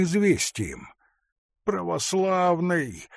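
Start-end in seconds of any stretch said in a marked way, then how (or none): 1.6-3.1 high, slow, plaintive call; tearful voice. A narrator's reading. Frightened forester appeals to the hunter, tearfully. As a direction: plead as if close to tears. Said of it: "сказал он плачущим голосом".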